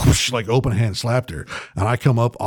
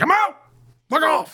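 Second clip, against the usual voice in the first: very high mocking voice; hammed-up retold brush-off; mock exit. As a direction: toss it off high and dismissive. Leaving the party.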